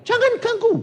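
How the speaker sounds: very high, loud cry; shouted warning. Retold or imitated. Man in the mob recognizes the doctor, high loud warning shout. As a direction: imitate a man yelling to halt a mob. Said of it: "satu orang berteriak".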